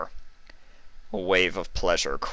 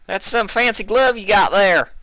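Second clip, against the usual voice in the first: shouted over gunfire; 8 dB louder than usual. A narrator's reading.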